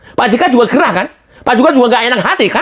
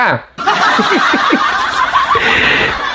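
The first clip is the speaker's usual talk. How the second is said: high rising burst of laughter; 11 semitones higher than usual. Real, not acted. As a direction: crack up mockingly. Laughs at JK again.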